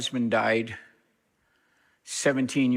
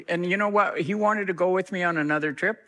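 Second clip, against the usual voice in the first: normal talking voice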